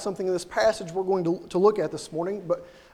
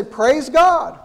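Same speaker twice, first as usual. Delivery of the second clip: "Praise God" called out high and loud; exclaimed praise; 5 semitones higher, 8 dB louder than usual. Shown as a demonstration. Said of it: "we say".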